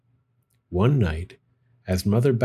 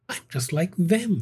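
thought; 6 semitones higher than usual. A narrator's reading. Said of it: "thought Stella Luna".

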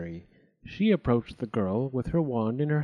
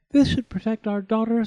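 high, louder queen; queen hopeful; 9 semitones higher, 6 dB louder than usual. A narrator's reading. Queen by the fire, higher and louder. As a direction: reassure yourself before the fire. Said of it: "said the queen".

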